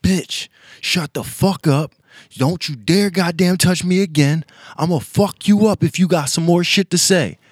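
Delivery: loud, slow furious retort; a man finally snapping at his girlfriend; snapping back angrily. Retold or imitated. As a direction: snap at her, fed up and threatening.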